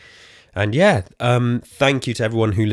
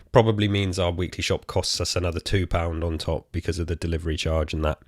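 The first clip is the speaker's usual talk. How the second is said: ordinary delivery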